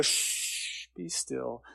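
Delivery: soft hush, then a quiet command; calm the storm with a whisper. Acted. Jesus stilling the storm with a soft, whispered hush.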